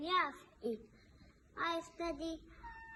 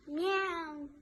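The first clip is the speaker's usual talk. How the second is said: single drawn meow; cat meow; 3 dB louder than usual. Shown as a demonstration. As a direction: imitate a cat's meow. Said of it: "I can cat".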